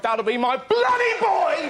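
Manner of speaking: dad calling out in resigned fury; a long-suffering father bellowing; exasperated dad shout. Retold or imitated. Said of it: "obviously heard this and went".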